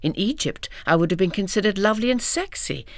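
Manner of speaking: usual speaking voice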